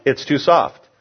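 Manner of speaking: ordinary talk, nothing marked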